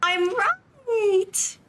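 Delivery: thrilled high shout of victory; excited cheer. Real, not acted. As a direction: cheer like you just won. Guessed the character correctly.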